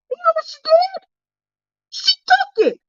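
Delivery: very high, scandalized disbelief; comic indignation. Retold or imitated. Telling friends the snack was not politely declined, comic indignation. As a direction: squeal it in mock disbelief.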